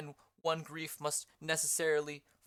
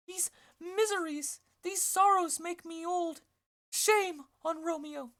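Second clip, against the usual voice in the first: put-on high-pitched old nurse voice, rising to loud complaint; the Nurse lamenting and calling for brandy, high; 14 semitones higher, 6 dB louder than usual. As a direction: play the Nurse pitched way up, railing at men. Nurse's lines in read play.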